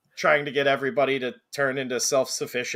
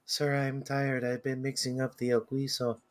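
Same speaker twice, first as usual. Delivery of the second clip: innocent and soft; 8 dB quieter than usual. Retold or imitated. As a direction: sound tired and innocent. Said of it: "Very innocent and soft".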